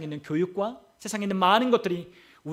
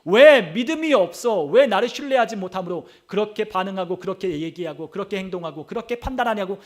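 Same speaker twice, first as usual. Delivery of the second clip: imitated rebuke swelling to a loud peak; retold scolding; 5 dB louder than usual. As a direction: hammer out accusing questions as the Lord's reproach. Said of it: "주님이 우리에게 꾸짖는 거예요".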